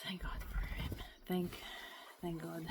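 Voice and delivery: soft whispered thanks. Real, not acted. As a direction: breathe it out quietly. Whispered aside.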